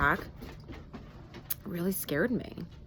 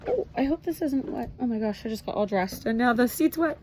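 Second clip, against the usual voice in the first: dismayed surprise; 7 semitones higher than usual. Real, not acted. Finds the seat wet.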